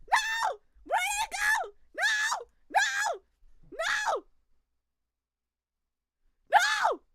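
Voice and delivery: very high, loud shrieks; repeated shrieking dismay; anguished screaming. Real, not acted. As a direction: wail it out in horror. Streamer screams over closed game.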